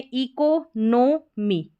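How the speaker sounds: Economy split as ee-ko, no, mee; broken into parts. Shown as a demonstration. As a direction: sound out economy piece by piece. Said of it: "हमारा spelling pattern है शुरू में". (Economy को टुकड़ों में तोड़कर)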